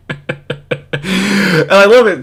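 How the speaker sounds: ordinary delivery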